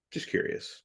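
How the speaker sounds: soft breathy whisper, almost to himself; whispered aside. Real, not acted. Musing about the young man's past, whispered. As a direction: let it slip out as a hushed afterthought.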